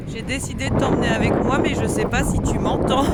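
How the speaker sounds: loud, high voice carrying over the wind; shouting over wind. Real, not acted. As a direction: shout so the camera hears over gusts. At the beach in strong wind.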